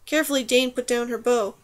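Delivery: ordinary delivery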